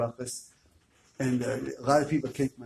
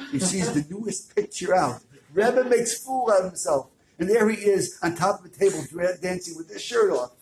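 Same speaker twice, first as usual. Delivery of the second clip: excited; 6 semitones higher, 6 dB louder than usual. A narrator's reading. Dramatic reveal of the picture.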